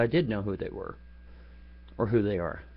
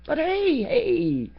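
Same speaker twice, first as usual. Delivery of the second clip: mocking; slower than usual. Mocking the anti-gun crowd.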